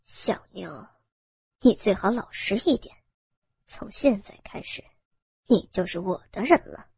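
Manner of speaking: low, soft menacing gang boss; cold sneer. Acted. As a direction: threaten the captive in a low, possessive sneer. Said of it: "头目冷笑道". (头目低沉冷笑威胁)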